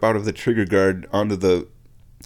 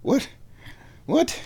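high, incredulous shocked voice; shocked viewer; 10 semitones higher than usual. Retold or imitated. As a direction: play the stunned friend. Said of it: "I know what you're thinking".

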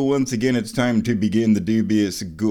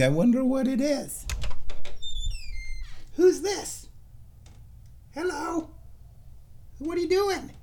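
much higher sing-song voice for the cat; cat talk; 3 dB quieter than usual. Real, not acted. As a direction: coo at the cat through the door. Talking to the cat behind door.

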